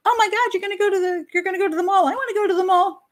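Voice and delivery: put-on high whiny little girl voice, pleading to tag along; bored whining kid. Bored girl begging to join the mall trip, high whine. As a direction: whine it like a bored nine-year-old. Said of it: "She's sitting home going".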